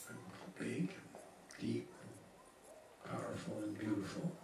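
soft, tentative self-description; unconvincing. Retold or imitated. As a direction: say it quietly, without believing it. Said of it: "that's not terribly convincing somehow".

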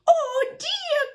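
very high-pitched grandma's cry; dismayed cry. A narrator's reading. Grandma woken by the banging, high cry of dismay. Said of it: "cried the grandma".

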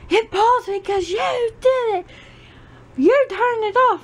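high whiny child voice, mimicking her son; imitating angry kid. Mother mimics her son's bedtime protest in a high whiny kid voice. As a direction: whine it like a sulky little boy. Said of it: "he's like".

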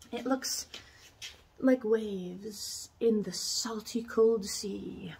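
slow, breathy whispered words; dreamy whisper. Real, not acted. Describing the wench corset to viewers, dreamy whisper. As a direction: whisper it like a sea poem.

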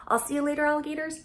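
cheery sign-off. Real, not acted.

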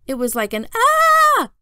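high, loud drawn-out "ah"; mock yell. Little brother demonstrates the yell to Mom, loud mock shout. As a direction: show off the yell again, slowly.

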